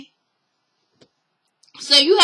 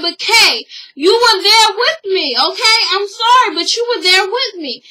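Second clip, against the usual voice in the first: raised, higher voice acting out a protest; insistent defense; 5 semitones higher, 4 dB louder than usual. Friend defends herself to Kay over the card, mock protesting. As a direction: plead your side in a retold argument.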